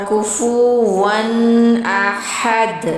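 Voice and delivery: very slow, کفواً احد drawn out; slow recitation demo. Shown as a demonstration. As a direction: stretch کفواً and احد word by word. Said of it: "اس طرح ہم اس کو وہ پڑھیں گے".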